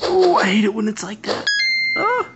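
high squealing complaint; frustrated squeal. Real, not acted. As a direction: squeal it out in annoyance.

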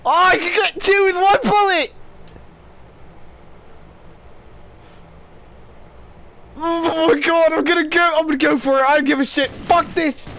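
gleeful build-up to a reckless move; excited. Real, not acted. Gamer to viewers before shooting his teammates, giddy and excited.

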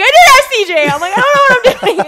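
high, loud frantic yelling with laughter; panicking. Retold or imitated. Athlete retelling her double-under panic, frantic and laughing. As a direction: re-enact a panic, cracking up. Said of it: "I'm panicking".

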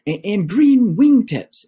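a little bear cub rushing to add details; excited cub. Acted. Cub telling a tall tale.

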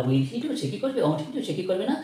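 ordinary talk

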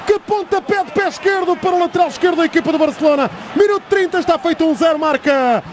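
excited goal call, pitch rising; fast dramatic football commentary. Real, not acted. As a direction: shout the goal, building fast.

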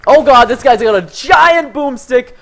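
ordinary talk, nothing marked

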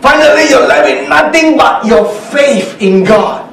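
high, loud climactic sermon delivery; impassioned proclamation, pitched high; full-force preaching. Real, not acted. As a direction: preach it urgently, rising to a drawn-out cry.

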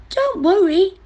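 very high, reassuring mouse; little mouse voice. Acted.

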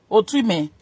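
usual speaking voice

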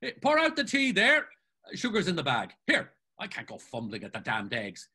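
high, effusive relief settling into orders; exuberant bossy Mulligan. Acted. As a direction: gush thanks, then fuss over breakfast. Mulligan relieved, orders everyone about.

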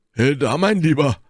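loud, high-pitched hail; Porthos. A narrator's reading. Porthos hails Athos in protest, calling out. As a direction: call out sharply, pitched well up.

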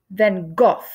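"Van Gogh" said the English way, ending in a hard "goff"; British "Van Gogh", rhymes with "off"; English pronunciation. Shown as a demonstration. Teacher showing kids the English version, pronouncing it. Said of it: "So in England, people called him".

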